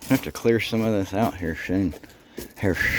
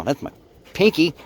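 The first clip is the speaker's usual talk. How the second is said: ordinary delivery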